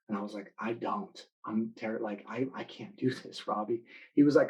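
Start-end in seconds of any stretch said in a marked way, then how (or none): 0.6-3.8 soft, defeated mock whimper; scared protest. Sophomore pleads with his hero he can't lift it, hushed and scared. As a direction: back down in a small scared voice. Terrified sophomore under heavy bar.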